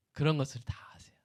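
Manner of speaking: hushed whisper. Acted. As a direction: drop to a whisper, softly. Soft climax of sermon point. (속삭이듯 작게)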